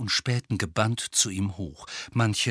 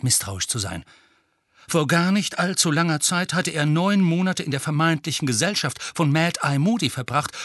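plain reading voice

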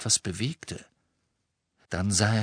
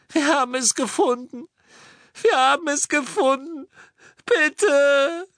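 very high, agonized pleading wail; tormented high scream; 5 dB louder than usual. A narrator's reading. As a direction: plead in terror, wailing louder. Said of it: "Hermine schrie von neuem".

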